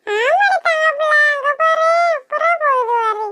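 grandma humouring the little one; grandmother. Acted. Grandmother answering the child. (பாட்டியின் செல்லக் குரல்)

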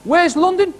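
put-on high-pitched drunk heckler calling out; imitated heckle from a drunk, pitched right up; mock drunken shout. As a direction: holler it up high like a drunk in the crowd. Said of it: "a drunk over there shouted".

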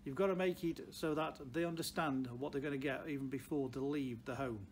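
ordinary talk, nothing marked

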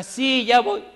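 respuesta aguda y fuerte; sí alegre. Retold or imitated. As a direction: contesta con entusiasmo inesperado. La señora acepta por fin. (la señora acepta ir, agudo y fuerte)

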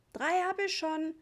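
put-on high, drawn-out child's cry; child yelling. A narrator's reading. Richie calling from the darkness about mosquito bites, yelling. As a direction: call out slowly in a high voice. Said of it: "schrie Richie irgendwo in der Dunkelheit".

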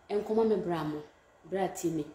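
ordinary talk, nothing marked

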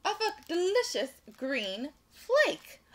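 high, loud, slow reveal; big storybook relief, word by word; happy twist. A narrator's reading. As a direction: draw out the surprise for little listeners. Suspense resolved in story for children.

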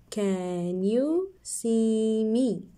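slow, drawn-out question; slow reading. Shown as a demonstration. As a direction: drag every word. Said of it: "Let's read it together".

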